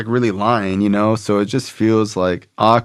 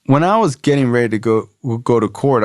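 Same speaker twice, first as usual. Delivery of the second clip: ordinary talk, nothing marked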